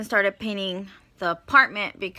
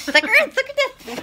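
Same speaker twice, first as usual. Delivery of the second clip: high, loud, fast mocking echo; mocking echo of the sister; 14 semitones higher, 7 dB louder, much faster than usual. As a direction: parrot her words up high.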